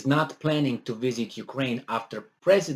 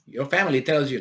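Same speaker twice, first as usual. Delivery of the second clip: ordinary talk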